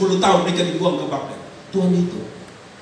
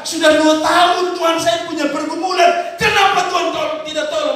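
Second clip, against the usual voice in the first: complaining believer; 8 semitones higher, 4 dB louder than usual. Acted. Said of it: "Saudara berkata".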